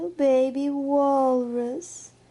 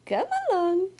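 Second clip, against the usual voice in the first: ordinary delivery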